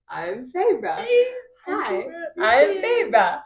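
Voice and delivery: high, drawn-out imitated greeting; slow, high-pitched mock introduction; acted nervous introduction. Acted introduction to panicking attacker.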